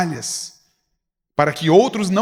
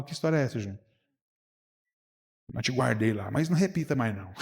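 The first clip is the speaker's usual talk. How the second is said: softer, as if pulling Júnior aside; a private word with Júnior, much softer and confidential; hushed private scolding; 6 semitones lower, 10 dB quieter, faster than usual. Retold or imitated. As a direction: scold him quietly, between you two.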